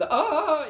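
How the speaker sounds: long, high, loud "ah" imitating a jungle call; Tarzan yell. Explaining popular culture gets it right, mock Tarzan yell. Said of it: "the Tarzan bit with that".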